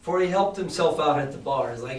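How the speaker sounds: usual speaking voice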